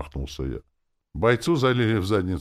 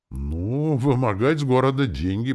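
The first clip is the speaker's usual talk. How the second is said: plain reading voice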